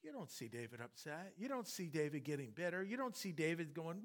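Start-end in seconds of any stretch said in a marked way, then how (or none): none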